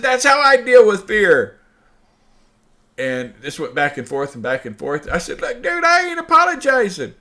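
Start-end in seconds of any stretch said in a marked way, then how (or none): 0.0-1.6 nervous laughing. Retold or imitated. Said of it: "I just start laughing". 5.4-7.1 high, shouted refusal; a loud, stubborn "no way"; defiant shout. Retold or imitated.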